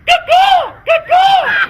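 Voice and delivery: very high loud cupped-hands cuckoo; bird call. Acted. Jason summoning the birds, very high loud cuckoo call. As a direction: call it out to the sky. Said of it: "I looked to the sky and cupped my hands".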